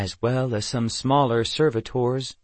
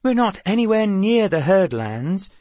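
high, loud indignant protest; complaining; 9 semitones higher, 5 dB louder than usual. Acted. Eve objects to Amulon closing the road, complaining. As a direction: protest it in a higher, pushed voice. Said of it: "Eve complained".

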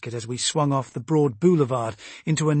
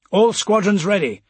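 raised, loud readiness call; military report; 6 semitones higher, 6 dB louder than usual. A narrator's reading. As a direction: report in over the radio. Captain reports readiness over radio.

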